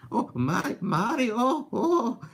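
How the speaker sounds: high, nervous whimpering Luigi calling for his brother; timid cartoon plumber lost in a haunted house; scared Luigi impression. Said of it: "That's my Luigi impression".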